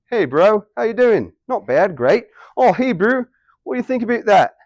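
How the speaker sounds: high, breezy Kiwi chat; New Zealand accent. Shown as a demonstration. Teacher acting out mates catching up, in New Zealand accent. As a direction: greet a mate in broad Kiwi. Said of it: "that's me saying".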